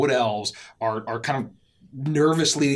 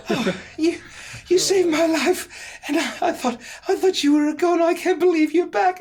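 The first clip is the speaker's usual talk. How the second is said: high, emotional mother voice; overcome mother; 3 dB louder than usual. Acted. Mother hugging the one who saved her, overcome. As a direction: gush with relief in a high voice. Mother embraces child's rescuer.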